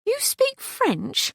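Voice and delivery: high and slow, amazed question; surprised. Acted. Catherine, amazed the parrot talks French, asks high and slow. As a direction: draw it out high in disbelief. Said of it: "in surprise".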